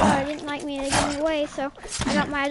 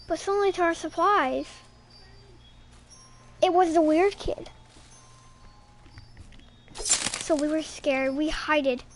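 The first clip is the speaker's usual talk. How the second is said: ordinary delivery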